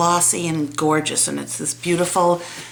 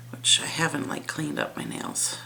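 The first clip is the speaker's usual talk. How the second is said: whispered; 3 semitones lower, 8 dB quieter than usual. Real, not acted. Embarrassed aside about nails.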